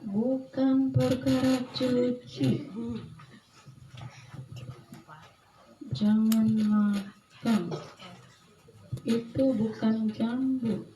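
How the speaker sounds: very slow, measured warning; drawn-out, deliberate caution; grave warning. Retold or imitated. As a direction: stretch each word out to warn. His own grave warning, retold.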